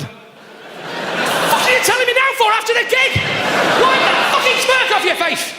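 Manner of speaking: high, drawn-out exasperated rant; mock outrage. Comic berating his tour mate after the gig, mock-furious. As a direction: round on him in hammed-up fury.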